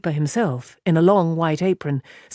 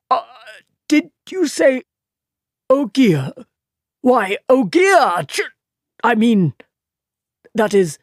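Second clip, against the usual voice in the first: higher, halting nervous babble; flustered stammer; 4 semitones higher, 4 dB louder than usual. A narrator's reading. Frightened innkeeper stammering at the Ogier. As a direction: stumble over the words, scared.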